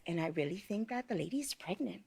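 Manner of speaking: hushed gossip whisper; a friend whispering the rumor; retold whisper. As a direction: lean in and whisper the secret. Friend tells secret gossip.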